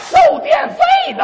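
very high, loud squawked answer; shrill parrot blurting it out. Acted. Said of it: "这时候鹦鹉说话了".

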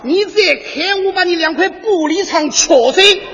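put-on Yangzhou accent. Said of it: "我就是扬州的叶塞尼亚".

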